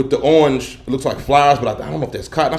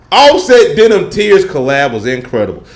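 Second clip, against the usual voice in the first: normal talking voice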